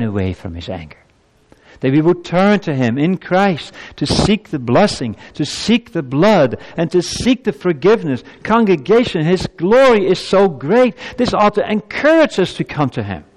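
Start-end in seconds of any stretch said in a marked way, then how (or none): 1.8-13.2 high, ringing pulpit appeal; impassioned preaching. Real, not acted. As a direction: build to a fervent, high-pitched exhortation. Preacher builds to urgent appeal.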